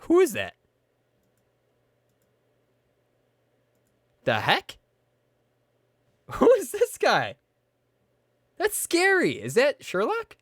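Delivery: high, startled disbelief; spooked. Real, not acted. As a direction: voice leaping up in alarm. Spooked by glitch in game.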